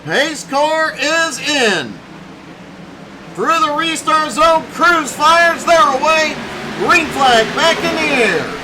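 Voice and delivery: punchy live motorsport commentary; excited play-by-play. Real, not acted. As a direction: call the restart with building excitement. Live restart call.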